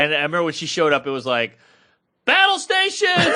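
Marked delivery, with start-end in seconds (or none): 2.2-3.3 high, loud rallying cry of battle stations; mock alarm shout. Comedian retelling producer's arrival, mock shout. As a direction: bark it like a ship's captain sounding alarm.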